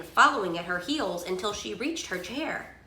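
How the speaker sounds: usual speaking voice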